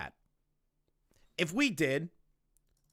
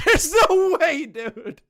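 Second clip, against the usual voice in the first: laughing disbelief; 12 semitones higher, 6 dB louder, slower than usual. Real, not acted. Laughing reaction to clip.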